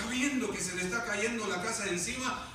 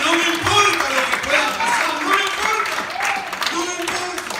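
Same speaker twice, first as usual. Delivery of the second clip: loud, high, defiant praise; joyful shout; 9 semitones higher, 16 dB louder than usual. Acted. Believer praising God, shouting that nothing matters. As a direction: cry it out with joy, higher and louder.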